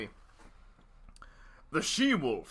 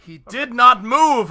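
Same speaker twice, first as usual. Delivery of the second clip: loud dramatic reveal; 9 semitones higher, 15 dB louder than usual. Acted. Dramatic reading emphasis.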